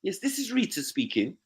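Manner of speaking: high, prim telephone voice; posh phone voice. Retold or imitated. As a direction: answer in a fake polite office voice. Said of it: "it's work-related or anything".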